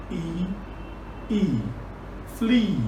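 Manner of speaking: usual speaking voice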